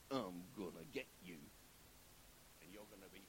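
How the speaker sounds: low, soft menacing threat; Jezebel. Retold or imitated. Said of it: "Jezebel says to him".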